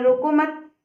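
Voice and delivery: "रोको मत" joined, high and loud; रोको मत as one unit. Shown as a demonstration. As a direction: punch "रोको मत" without a break. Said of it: "उसे रोकना नहीं".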